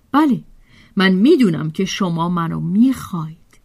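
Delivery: ordinary delivery, nothing marked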